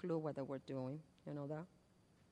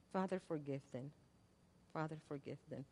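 much softer, lower prayer; hushed prayer; 5 semitones lower, 16 dB quieter, faster than usual. Acted. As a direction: breathe it out like a final plea. Prayer repeated softly after loud.

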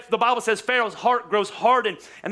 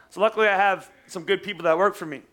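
normal talking voice

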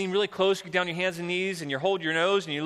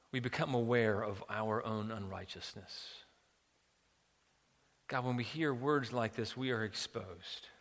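softer, measured praying voice; prayer; 3 semitones lower, 5 dB quieter than usual. Real, not acted. Preacher praying to God before the sermon, prayerful. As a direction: pray earnestly, quietly, letting phrases settle.

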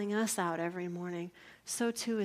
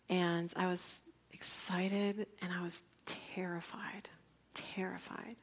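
soft unvoiced whisper; hushed awe; 5 dB quieter than usual. Real, not acted. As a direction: whisper it, reliving the fear. Retelling her fear at birth.

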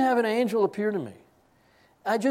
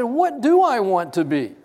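laughing question; 4 semitones higher, 7 dB louder than usual. Retold or imitated. Laughing as he asks.

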